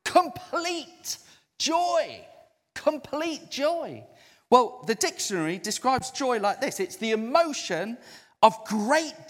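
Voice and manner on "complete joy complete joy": high, slow, stretched complete joy; savoured emphasis. Acted. Preacher savours key phrase.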